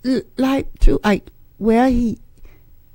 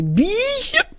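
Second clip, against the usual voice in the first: higher, softer sing-song Bishop with a rising end; softly called Bishop, pitched up; lady calling bishop; 8 semitones higher, 6 dB quieter than usual. Retold or imitated. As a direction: coo Bishop up high like the church lady did. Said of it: "and she'd say".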